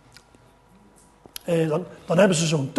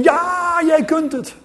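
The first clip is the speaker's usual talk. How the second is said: much higher, loud cheering shout; crowd shouting; 11 semitones higher, 8 dB louder, slower than usual. Acted. Crowd shouting encouragement to the tightrope walker. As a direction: cheer him on like a whole crowd. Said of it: "En iedereen riep van".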